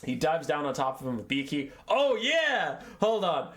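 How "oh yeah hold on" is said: high, louder blurt as a memory hits; sudden recollection. Real, not acted. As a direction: interrupt yourself excitedly.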